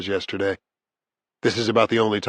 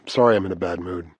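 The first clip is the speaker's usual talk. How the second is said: usual speaking voice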